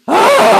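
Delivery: loud groan breaking into a laugh. Shown as a demonstration. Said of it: "Let's groan again".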